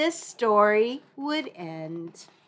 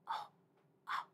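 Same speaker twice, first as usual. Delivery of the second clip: normal talking voice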